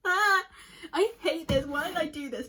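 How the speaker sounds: high, frustrated near-tears outburst; emotional. Real, not acted. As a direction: burst out, upset at yourself for crying. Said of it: "Why am I so emotional".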